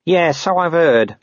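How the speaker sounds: slow, high-pitched acknowledgement; drawn-out, unimpressed underling; humouring corporal. Acted. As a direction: humour a preachy colleague. Nobby wearily humours pious colleague.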